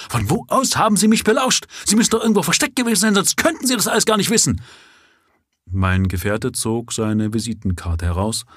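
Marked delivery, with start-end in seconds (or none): 0.1-4.6 high, fast, agitated accusation; frantic outburst. A narrator's reading. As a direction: leap up and fire the questions like a man possessed. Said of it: "schnellte wie besessen von seinem Sitz".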